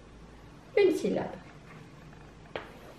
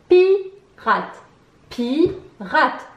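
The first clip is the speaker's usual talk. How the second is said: "Pirate" split with pi louder and higher, then rate; stress on pi; 3 semitones higher, 4 dB louder, much slower than usual. Shown as a demonstration. Teacher locating the sound pi, first beat of "Pirate" stressed and separated. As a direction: punch pi, pause, drop on rate in "Pirate". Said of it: "Où entend-on le pi".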